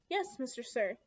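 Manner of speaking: ordinary delivery, nothing marked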